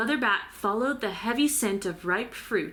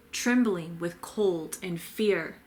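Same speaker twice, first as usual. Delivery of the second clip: ordinary delivery, nothing marked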